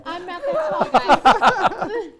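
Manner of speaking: laughing through the words; loud, slow, laughing protest. Real, not acted. Laughing protest at height joke.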